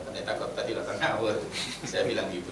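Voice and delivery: ordinary talk, nothing marked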